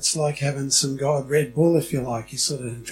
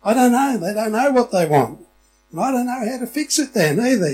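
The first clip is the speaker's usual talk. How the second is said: exasperated parent; 7 semitones higher, 6 dB louder than usual. Retold or imitated. Exasperated parent, crying baby.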